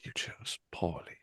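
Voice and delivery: low, slow whispered "You chose poorly"; movie quote whisper. Retold or imitated. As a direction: whisper the verdict gravely. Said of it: "Indiana Jones and the Last Crusade fans".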